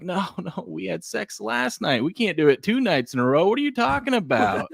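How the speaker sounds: high, incredulous husband protesting; mock refusing husband. Husband turning down his wife, high mock incredulity. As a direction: protest in a high voice, hamming up the disbelief. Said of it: "that's like a guy when his wife's like".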